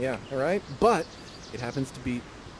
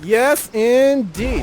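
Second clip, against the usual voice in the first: drawn-out yes indeed; 9 semitones higher, 9 dB louder, much slower than usual. Real, not acted. Host savours the choice.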